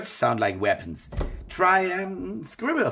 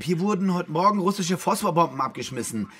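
calling from far away; 7 semitones higher, 4 dB louder than usual. Acted. Calling from Idlib bad line.

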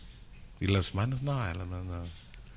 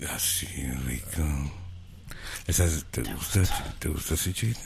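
low breathy whispered seduction; sexy whisper; 10 semitones lower, 8 dB quieter than usual. Pretend. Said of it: "voz sexy / crónicas".

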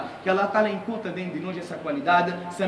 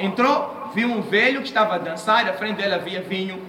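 ordinary delivery

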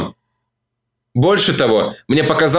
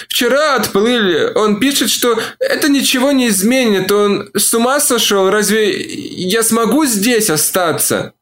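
despairing woman; 8 semitones higher than usual. A narrator's reading. Olivia in despair.

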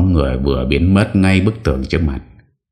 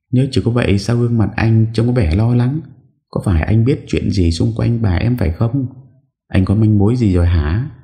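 put-on higher young woman's voice, anxious and questioning; higher wife firing off worried questions; higher young woman, pressing her husband; 8 semitones higher than usual.

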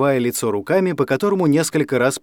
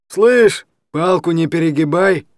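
higher, louder threat at the start; threatening; 4 semitones higher, 4 dB louder, slower than usual. A narrator's reading. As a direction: warn him off, menacing. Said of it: "с угрозой сказал Старый". (угрожающе, с напором)

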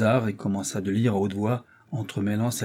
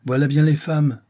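scornful father; 5 semitones higher, 6 dB louder than usual. Acted. Father scoffs at women.

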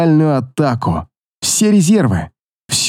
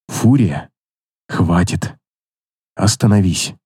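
gentle, low plea; 9 semitones lower than usual. A narrator's reading. Pleading with her to stop.

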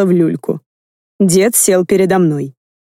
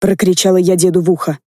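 plain reading voice

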